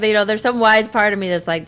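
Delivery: ordinary delivery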